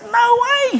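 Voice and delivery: high-pitched astonished retort; amazed disbelief. Retold or imitated. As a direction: blurt it out in soaring disbelief. Amazed reaction to friend's conversion.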